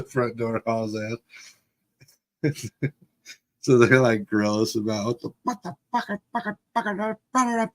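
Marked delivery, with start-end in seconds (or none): 5.2-7.7 very high, softened rapid-fire mock cursing; imitated sputtering outburst. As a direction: sputter like an appalled parent in caricature. Imitating parents' outburst, retold.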